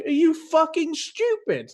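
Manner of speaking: high, drawn-out mock incredulity; retold indignant outburst. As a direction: replay your outraged disbelief at an opponent. Retold angry confrontation with opponent.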